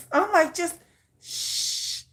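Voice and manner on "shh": hushed shh. Shown as a demonstration. Shushing to show how a man should talk, hushed. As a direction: hush him like a secret. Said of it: "I like a whisper".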